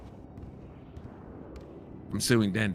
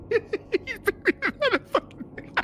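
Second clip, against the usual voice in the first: laughing. Real, not acted. Laughing at ping.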